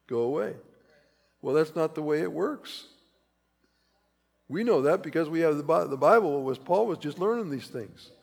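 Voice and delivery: ordinary delivery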